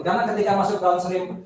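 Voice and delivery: ordinary talk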